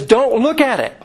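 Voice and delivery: mock yell of warning, voice lifted; retold urgent warning. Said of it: "he's still yelling at her".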